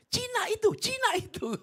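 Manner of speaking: very high, loud squeal; gang members' shout. Retold or imitated. Thugs spot the passing preacher, high mock shouting. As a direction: mimic onlookers crying out in a piercing voice. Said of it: "tiga orang itu berkata".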